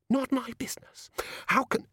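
high, thin squeak of indignation; indignant. Acted. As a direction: echo it back in outraged disbelief. Susan indignant at being dismissed.

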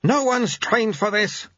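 high, slow growling retort; acted gruff rebuke; growled. A narrator's reading. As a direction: growl it back, stretching the words. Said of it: "growled Lutze".

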